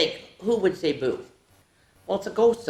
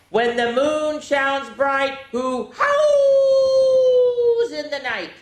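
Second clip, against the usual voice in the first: howling werewolf; 11 semitones higher, 7 dB louder, much slower than usual. Acted. Werewolf howl riddle.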